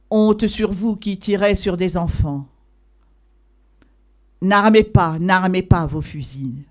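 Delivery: loud, ringing reproach that peaks on N'armez pas; indignant outcry. Acted. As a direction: cry shame at the soldiers who fire on children. Old man confronts soldiers.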